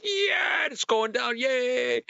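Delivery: high-pitched mock cheer; retold elation. Snaker reliving the drain starting to clear, elated high cheer. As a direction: squeal it like a short-lived victory.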